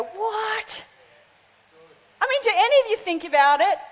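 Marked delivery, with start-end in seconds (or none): 0.0-0.8 high-pitched, drawn-out mock disbelief; incredulous reaction. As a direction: let the pitch leap up in puzzlement. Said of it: "we're just gonna go".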